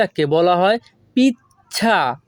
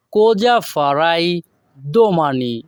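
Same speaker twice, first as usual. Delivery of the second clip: slow, careful Italian model sentence; slow model phrase; slower than usual. Shown as a demonstration. Teacher modelling the Italian sentence slowly for students. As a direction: say it slowly for learners. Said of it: "এখানে যদি আমরা ভেঙে ভেঙে বলি".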